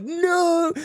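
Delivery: high-pitched, drawn-out "no" of alarm; mock panicked cry. Teacher reacting to a kid's broken arm, panicked. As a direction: imitate a teacher freaking out, pitch way up. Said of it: "freaking out".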